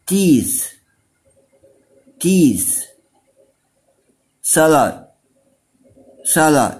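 high, clear model words for the class to repeat; teacher drill voice. Shown as a demonstration. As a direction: lead the kids through the drill. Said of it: "repeat after me". (صوت المعلمة وهي بتخلي الأطفال يرددوا)

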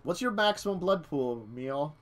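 normal talking voice

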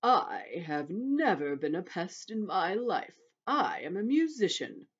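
put-on slow, scornful old grasshopper voice; deep, slow, scornful. A narrator's reading. Old grasshopper rebuking the centipede, slow and scornful. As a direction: draw it out with haughty scorn. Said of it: "speaking in a deep, slow, scornful voice".

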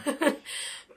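short breathy giggle; breathy laugh. Real, not acted. Laughs at host's remark.

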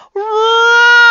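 huge, high, blasting roar; lion roaring at the librarian; loudest lion roar. Acted. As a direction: let out the loudest roar of your life. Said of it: "he roared the loudest roar he had ever roared".

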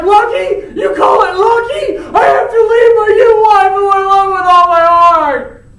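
very high, loud, indignant shouting; outraged Romeo. Retold or imitated. Romeo shouts back at the friar in outrage. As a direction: hurl lucky back at him. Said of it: "And Romeo's like".

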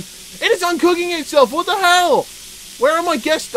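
high-pitched, exasperated disbelief; incredulous outcry. Real, not acted. Gamer watching meat uncook itself, high incredulous outcry. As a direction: wail it out in rising panic.

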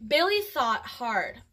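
ordinary delivery, nothing marked

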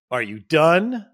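snippy are you done. Shown as a demonstration. Husband showing the wrong way to ask his wife, snippy. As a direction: toss it back with a sharp rising edge. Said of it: "But not in a".

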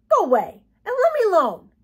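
high, loud, whining complaint; irritated protest. Acted. Boy pinched awake, loudly irritated. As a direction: whine loudly to be left in peace.

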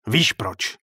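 loud, sharp question; pointed, angry challenge. A narrator's reading. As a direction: fire it straight into the face. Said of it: "Vpálil mu naštvaně přímo do tváře".

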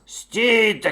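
drawn-out loud command; bear shouting. A narrator's reading. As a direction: order them to halt like a big bear. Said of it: "кричить".

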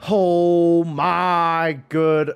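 very slow, loud, stretched-out exclamation; drawn-out dismay. Real, not acted. Gamer reacting to a setback, drawn-out dismay. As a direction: drag every word out in disbelief.

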